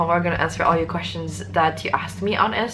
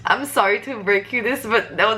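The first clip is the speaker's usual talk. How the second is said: ordinary talk, nothing marked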